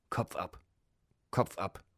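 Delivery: lightly voiced shrieking parrot; a restrained mock squawk, not shouted; hint of a screeching parrot; mild parrot screech. A narrator's reading. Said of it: "schrie das Vieh gellend".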